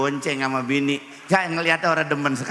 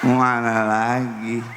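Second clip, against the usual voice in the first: slurred wife, laughing; 6 semitones lower than usual. Retold or imitated.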